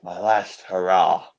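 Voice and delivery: slow, drawn out, solemn. Acted. Proud man savouring his last hurrah, slow and solemn. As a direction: let each word sink in slowly.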